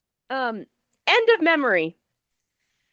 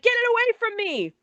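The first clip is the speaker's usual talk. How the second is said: mock panic; 9 semitones higher than usual. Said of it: "and he's like".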